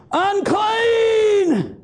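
high, drawn-out warning cry. Acted. Leper warning people off as the law requires, crying out. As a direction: call it out long, as if from off in the distance. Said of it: "He's crying out".

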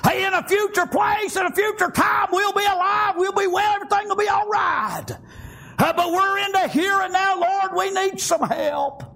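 sustained preaching cry for retold sisters; impassioned plea. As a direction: plead it out on a held note.

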